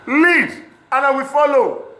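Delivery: high, loud, slow dare; challenging shout. Real, not acted. Daring critics to lead the protest, loud and high. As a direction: throw the challenge at your critics.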